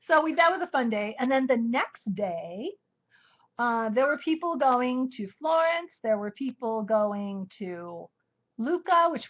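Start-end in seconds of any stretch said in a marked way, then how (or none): none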